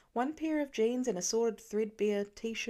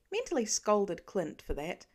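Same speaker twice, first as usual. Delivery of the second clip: usual speaking voice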